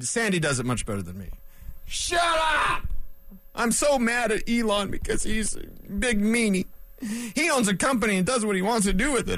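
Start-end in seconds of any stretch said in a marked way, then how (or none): 1.9-2.8 high, slow, drawn-out mock shout; hammed-up shout at whiny fans; shouted shut up. Sandy drop repeated. 3.5-9.4 mock outrage. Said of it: "the faux outrage".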